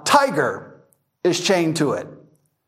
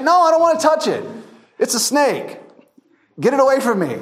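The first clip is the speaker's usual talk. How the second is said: much higher, quicker and insistent; panicky refusal; 7 semitones higher than usual. Retold or imitated. Tourist refusing to touch the snake, panicky.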